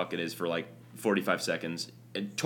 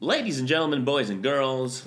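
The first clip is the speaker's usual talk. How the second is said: announcer voice; 4 semitones higher than usual. Acted. Host reopening the show in an announcer voice. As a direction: stretch it like a ring announcer.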